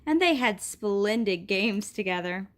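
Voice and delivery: usual speaking voice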